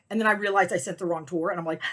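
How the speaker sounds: normal talking voice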